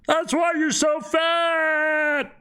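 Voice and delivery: high, slow taunting yell; high drawn-out shout. Acted. Dying character jeering as it caves in on him, high shout. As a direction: stretch it out in a high-pitched cry.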